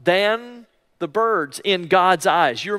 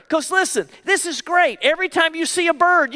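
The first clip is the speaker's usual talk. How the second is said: usual speaking voice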